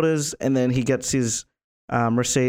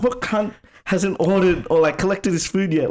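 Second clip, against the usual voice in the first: higher-pitched mock irritation; retold anger; 7 semitones higher than usual. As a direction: imitate an angry customer, pitch raised. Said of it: "the guy's fucking angry".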